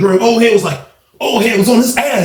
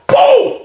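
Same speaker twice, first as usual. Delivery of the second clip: sharp high punch noise; imitated punch sound. Narrating a punch.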